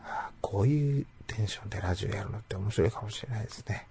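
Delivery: drowsy, half-asleep whisper; sleepy whisper. Real, not acted. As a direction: whisper as if about to fall asleep. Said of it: "本当に寝そうです".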